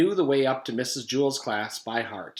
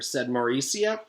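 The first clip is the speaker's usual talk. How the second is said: ordinary delivery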